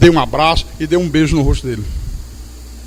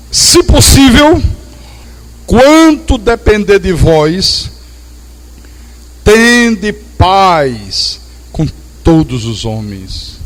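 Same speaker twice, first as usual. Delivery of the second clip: slow solemn scripture proclamation; solemn scripture reading; 4 dB louder, much slower than usual. Acted. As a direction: proclaim the verse slowly from the pulpit. Reads verse in preaching voice.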